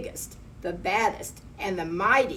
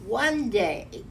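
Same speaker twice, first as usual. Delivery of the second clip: ordinary delivery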